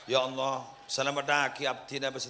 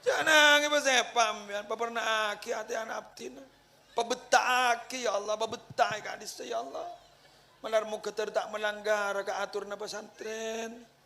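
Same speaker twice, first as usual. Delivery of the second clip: higher, pleading prayer with a hammed-up crying tone; mock tearful plea for the child; mock weepy prayer; 8 semitones higher than usual. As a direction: pray as if about to cry, half joking. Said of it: "Siapa sampai nangis?".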